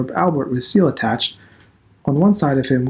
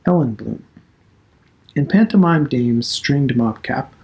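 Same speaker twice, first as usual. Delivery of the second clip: ordinary delivery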